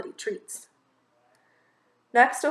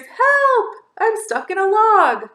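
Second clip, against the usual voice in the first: high, loud call for help from inside a log; a trapped bunny shouting for help; cry for help; 10 semitones higher, 8 dB louder, slower than usual. Acted. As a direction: yell for help, stuck and panicked.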